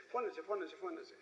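softly repeated urging, fading away. Retold or imitated. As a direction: egg your neighbour on under your breath. Mockers nudging each other among the crowd.

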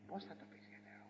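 breathy whisper, almost toneless; hushed rhetorical whisper; whispered aside. Acted. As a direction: drop to a whisper as if confiding. Aside in mock dialogue.

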